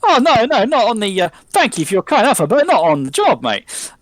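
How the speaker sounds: high, loud, polite landlord brush-off; cheery refusal. Acted. Landlord turning down drugs, loud and jolly. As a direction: wave off the offer brightly. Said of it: "Johnny offers him some drugs".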